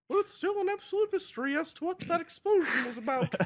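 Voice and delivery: very high cartoon daughter voice; Compote's voice. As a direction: squeak it out like a prissy lady. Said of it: "says Compote".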